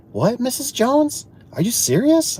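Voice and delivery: high-pitched mock incredulity; hammed-up astonishment; acted disbelief. Acted disbelief at caller's claim.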